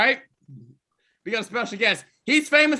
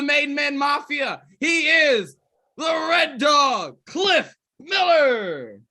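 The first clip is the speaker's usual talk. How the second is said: ring announcer. Acted. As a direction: announce each wrestler to the arena. Introducing the roster.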